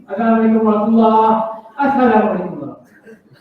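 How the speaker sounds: quick salams with a laugh breaking in; hurried imam parody; mock rushed salam. Shown as a demonstration. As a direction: rush through them half laughing. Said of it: "ni pun perlu rawatan".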